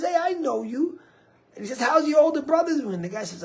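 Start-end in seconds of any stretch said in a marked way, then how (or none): 0.0-1.0 high, loud retold greeting; excited recognition. Volunteer recognises a soldier. 1.8-2.9 very high, loud acted question; eager greeting. Volunteer greets soldier, asks after brother.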